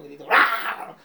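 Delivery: brief demonstration yell; demo shout. Shown as a demonstration. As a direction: show what a loud cry sounds like. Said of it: "Significa que gritó".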